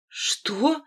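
high, hoarse startled question; husky, taken-aback query. A narrator's reading. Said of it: "хрипло переспросил я".

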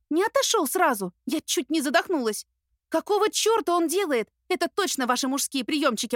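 panicky heroine. A narrator's reading.

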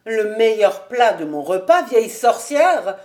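loud, outraged accusation; indignant shouted insult; angry exclamation. A narrator's reading. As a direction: explode at your mother-in-law. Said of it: "s'écria-t-il enfin".

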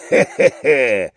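slow, low drawn-out cackle; loud guffaw. A narrator's reading. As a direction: cackle it out as a mocking drunk. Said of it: "ржал уже в полный голос".

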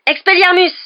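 high, full-voiced battle cry; loud, explosive incantation; shouted spell. A narrator's reading. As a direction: yell the spell at an enemy. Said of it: "cria Harry".

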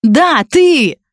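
put-on young woman's voice, pitched high and accusing; a jealous student girl lashing out. Jealous college girl confronting Dan, high accusing voice.

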